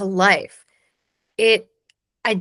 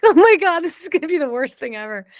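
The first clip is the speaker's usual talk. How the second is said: panicked; 7 semitones higher, 4 dB louder, much faster than usual. Retold or imitated. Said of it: "And I was like".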